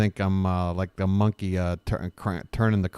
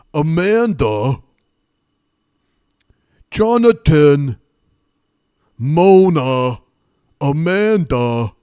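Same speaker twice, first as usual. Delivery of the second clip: drawn-out, higher, much louder calling of names; Tony Danza impression; 7 semitones higher, 11 dB louder, slower than usual. Streamer imitates Tony Danza calling names, loud and slow. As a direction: bellow each name slow like a sitcom dad. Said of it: "That's my Tony Danza impression".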